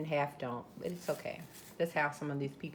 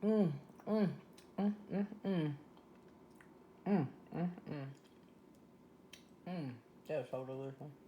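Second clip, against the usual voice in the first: savoring hums; 2 semitones lower, 6 dB quieter than usual. Real, not acted. Tasting the popper.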